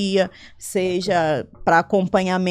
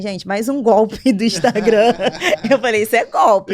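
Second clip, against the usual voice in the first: louder, laughing exclamation; laughing disbelief; 3 semitones higher, 5 dB louder than usual. Retold or imitated. Telling interviewers of her suspicion of a scam, laughing disbelief. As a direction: blurt it out through a laugh.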